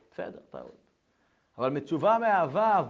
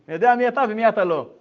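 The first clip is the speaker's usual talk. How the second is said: much louder, higher knowing jab; a father calling his son's bluff; father's loud retort; 8 semitones higher, 12 dB louder than usual. Retold or imitated. Father telling his son he knows him, loud and higher.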